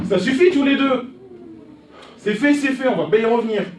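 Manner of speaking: loud, sharp order to stop; stern father shouting; angry shout. Acted. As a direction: shout them both down. Father silences quarreling children.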